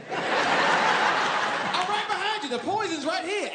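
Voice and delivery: drawn-out sly "sure, right behind you"; mock reassurance. Boyfriend fake-promising girlfriend he'll follow, mock sweet.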